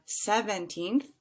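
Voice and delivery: put-on "seventeenth" sounded out, long "teenth"; three-syllable seventeenth. Shown as a demonstration. Teacher giving an example, "seventeenth" in three clear syllables. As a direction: stretch the last syllable of "seventeenth". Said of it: "which has three syllables".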